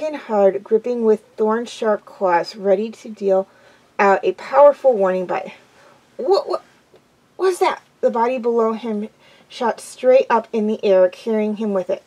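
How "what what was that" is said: high-pitched startled stammer; astonished. Acted. Warrior cat jumped from behind, astonished. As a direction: yelp it in astonishment. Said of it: "recognized the astonished voice".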